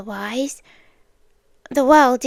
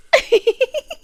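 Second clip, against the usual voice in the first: bright rising giggle, much higher; high fond laugh; 11 semitones higher than usual. Acted. Captor picturing domestic life with captive, giggling high. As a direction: laugh fondly at the image.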